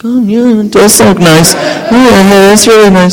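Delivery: mock-gushing chorus of colleagues; gushing agreement. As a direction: play the girls cooing over her hair. Other girls agreeing.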